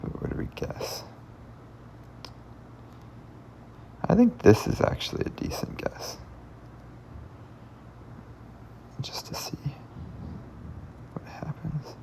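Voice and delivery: muttering under the breath while thinking; whispering. Real, not acted. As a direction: whisper it to yourself, puzzling. Thinking aloud over a puzzle.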